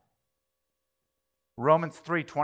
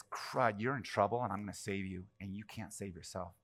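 soft, low reassurance fading away; tender rescue; 6 semitones lower, 10 dB quieter than usual. Retold or imitated. God to helpless people, quiet tender reassurance. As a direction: speak it as a gentle promise to save.

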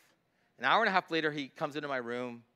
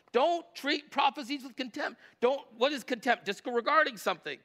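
raised, driving sermon voice, pitched higher; preaching at full force; 8 semitones higher, 4 dB louder than usual. Acted. Pastor urging the congregation not to despise prophecy, fervent preaching. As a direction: press each scripture home to the room.

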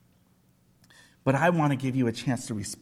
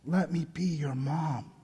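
tender; 8 dB quieter than usual. Retold or imitated. Holy Spirit's gentle words.

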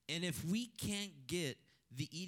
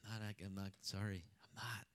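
hushed, breathy-free whisper of a sheepish no; retold whisper; 7 semitones lower, 7 dB quieter than usual. To the neighbor offering carne asada, a retold whisper. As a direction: whisper it like turning down food guiltily.

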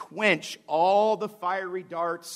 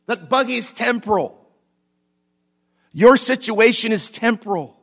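raised, higher-pitched preacher's proclamation; impassioned preaching; 5 semitones higher, 5 dB louder than usual. Real, not acted. Preacher assuring congregation their troubles are temporal, impassioned preaching. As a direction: drive each declaration home with force.

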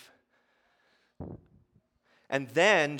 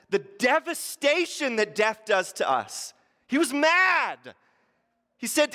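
loud, higher preacher climax building to joy; thundering pulpit sermon, louder and higher; full-force preaching; 8 semitones higher, 9 dB louder than usual. Acted. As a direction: preach it with everything you've got.

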